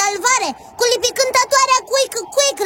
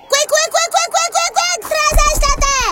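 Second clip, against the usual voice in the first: high, rapid chirps rising to a wake-up cry; wake-up call; 5 semitones higher than usual. Acted. Companion trying to wake the sleeping Quick Quick, high and insistent.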